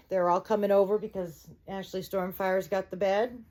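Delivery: plain reading voice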